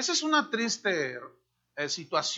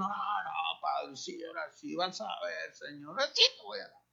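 hurried, softer mock vow to the Lord; empty promise; 3 semitones lower, 8 dB quieter than usual. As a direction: rush an insincere pledge. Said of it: "nomás se quedaron en la buena intención". (promesa vacía y apresurada, en tono fingido)

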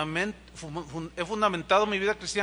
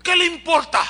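high, loud indignant retort; retorted snap; 9 semitones higher, 9 dB louder than usual. Retold or imitated. Offended mother snaps at the pastor, loud and high. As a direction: fire back like an offended mother. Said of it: "me miró así".

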